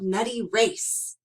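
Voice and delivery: usual speaking voice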